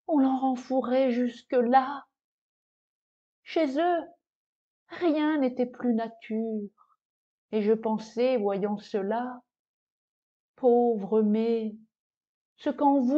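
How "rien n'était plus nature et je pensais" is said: plain reading voice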